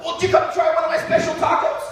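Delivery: high, eager offer; eager chef. Retold or imitated. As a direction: ask brightly like a proud cook.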